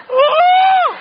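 high loud whooping cry; a crowd-rousing woo. Retold or imitated. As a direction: let out a wild high whoop.

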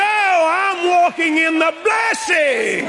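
high, drawn-out proclamation; triumphant preaching shout. Real, not acted. Preacher declaring blessing to the congregation, triumphant shout. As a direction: cry it out slowly at full conviction.

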